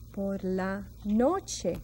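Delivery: por la noche stretched slowly; teacher models slowly for class; slow model phrase. Shown as a demonstration. Teacher showing learners the evening phrase, slow. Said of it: "we say".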